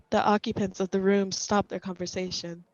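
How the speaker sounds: ordinary delivery, nothing marked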